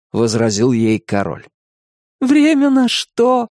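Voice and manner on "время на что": through tears. A narrator's reading. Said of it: "сквозь слёзы посмотрела".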